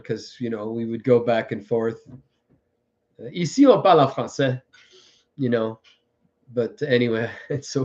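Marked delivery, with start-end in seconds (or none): 3.3-4.6 quick switch into French; playful French pronunciation; French accent. Acted. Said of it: "I'm French and I know the language".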